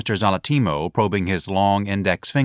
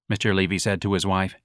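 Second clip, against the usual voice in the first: ordinary delivery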